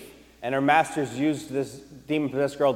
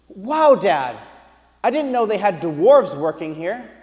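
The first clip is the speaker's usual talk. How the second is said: put-on child's innocent blurt; 6 semitones higher, 8 dB louder than usual. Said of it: "she's like".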